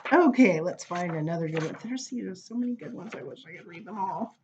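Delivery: usual speaking voice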